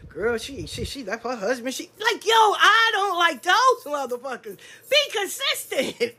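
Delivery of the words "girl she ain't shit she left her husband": softer, dishing dirt; lightly hushed gossip; catty gossip. Retold or imitated. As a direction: lean in and trash her. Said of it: "And the next one".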